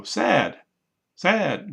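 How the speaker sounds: high, loud "sad", falling; word drill. Shown as a demonstration. Teacher drilling a feeling word, "sad" high and loud. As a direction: punch "sad" high each time for repetition. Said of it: "might go".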